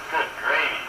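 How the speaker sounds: ordinary talk, nothing marked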